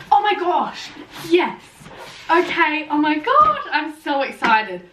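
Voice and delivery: high, loud gasp and squeal of delight; excited squeal. Real, not acted. Unboxing a surprise book from HarperCollins, squealing with delight. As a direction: shriek with joy over the surprise.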